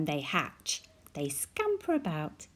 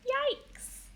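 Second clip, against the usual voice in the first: squeaky yikes. Acted. Fright at the shark.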